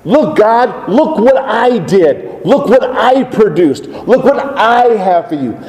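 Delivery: lightly voiced proud farmer presenting his crop; boastful Cain.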